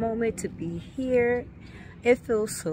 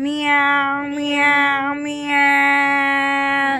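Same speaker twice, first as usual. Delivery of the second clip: drawn-out meows, getting louder; cat meow; 4 dB louder, much slower than usual. Pretend. Vlogger imitating a cat for viewers, playful meows. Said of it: "This is the cats meow".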